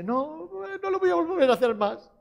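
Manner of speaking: high, whining mock sobbing; imitation of a child crying; tearful child. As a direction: plead like a crying child, hammed-up. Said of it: "Y viene llorando".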